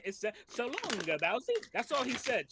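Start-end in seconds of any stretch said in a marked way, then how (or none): 0.5-1.5 lightly voiced Mario accent, pitched up; a hint of cartoon Italian. Explaining Mario's line to co-hosts, hint of cartoon Italian. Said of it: "because of Mario's accent".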